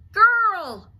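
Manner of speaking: loud high roar, falling away; roaring dragon. Acted. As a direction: roar her name. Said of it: "roared Dragon".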